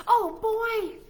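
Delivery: very high delighted gasp; excited squeal. Acted. Piggy spots ice cream, high excited squeal.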